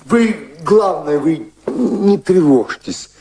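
slow soothing promise to a worried woman; gentle reassurance. Acted. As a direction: soothe her slowly, voice lifted. Reassuring distressed Mary.

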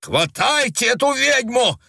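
high, sharp order; shout. A narrator's reading. Scratched agent to his men, shouted order. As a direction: yell the order. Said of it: "крикнул тот".

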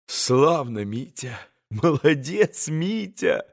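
soft praise with a touch of malice; understated venom, voice dropping on the name; spiteful exclamation. A narrator's reading. Grushenka cheers Mitya on, quietly spiteful. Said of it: "страшно злобная нотка прозвенела в её восклицании".

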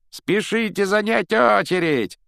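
high, drawn-out jeering shout; straining yell. A narrator's reading. Malfoy calls the pupils in the yard to queue, yelling. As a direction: holler it like a mocking barker. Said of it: "надрывал глотку Малфой".

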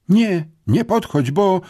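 high, loud, drawn-out warning; panicky, slow and high-pitched protest; alarmed warning. A narrator's reading. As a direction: ward them off, breaking off mid-threat. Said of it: "cofnęła się gwałtownie".

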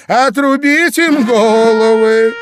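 high, loud, drawn-out death sentence; furious shout. A narrator's reading. Queen sentences the gardeners to death, furious shout. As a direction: shriek the order slowly and fully.

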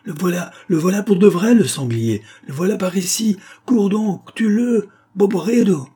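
high, loud alarmed yell; a voice shouting that the boar is here. Acted. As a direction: call out urgently across the field. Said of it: "une voix cria".

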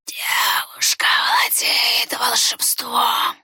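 slow raspy whisper, sly; sly rustling whisper. A narrator's reading. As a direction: rustle it slyly. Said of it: "лукаво прошелестел незваный гость".

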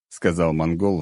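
ordinary delivery

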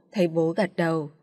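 ordinary delivery, nothing marked

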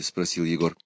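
ordinary delivery, nothing marked

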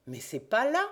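triumphant discovery; exclaiming. A narrator's reading. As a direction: cry it out as he spots the print. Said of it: "s'écria-t-il".

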